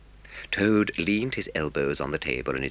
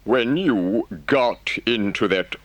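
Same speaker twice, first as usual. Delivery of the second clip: slowly and impressively; 6 semitones higher, 6 dB louder, much slower than usual. Acted. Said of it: "said the Rat slowly and impressively".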